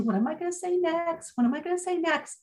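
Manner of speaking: distracted inner voice. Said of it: "I'm not tuning into".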